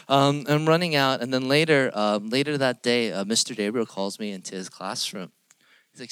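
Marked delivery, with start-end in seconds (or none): none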